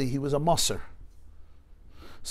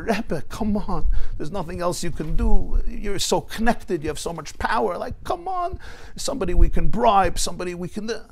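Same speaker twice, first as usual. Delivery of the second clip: pleading through tears; 7 semitones higher, 5 dB louder than usual. Retold or imitated. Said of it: "he starts crying even more".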